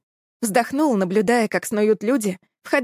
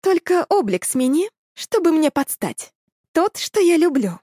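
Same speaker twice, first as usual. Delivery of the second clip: femme fatale; 8 semitones higher than usual. Acted. Cat woman makes a whim.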